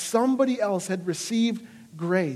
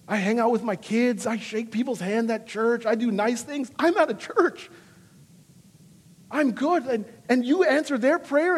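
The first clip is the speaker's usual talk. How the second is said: raised pitch, indignant self-pity, faster at the end; mock petulant prayer of a good boy; complaining to God, hammed-up indignant whine; whiny complaint to God; 6 semitones higher than usual. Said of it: "I found myself saying".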